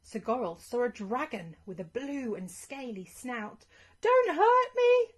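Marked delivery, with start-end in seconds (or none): 4.0-5.1 very high, loud, slow scared cry; frightened shout. Acted. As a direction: shriek it in fear. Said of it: "together with a shout".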